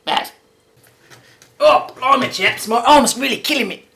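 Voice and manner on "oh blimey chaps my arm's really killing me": higher, louder Australian Steve Irwin impression; hammed-up Australian Steve Irwin bluster. To the viewers about his sore arm, Australian Steve Irwin impression. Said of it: "that was my Steve Irwin voice".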